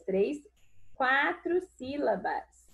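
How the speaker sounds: usual speaking voice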